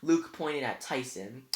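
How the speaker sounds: ordinary delivery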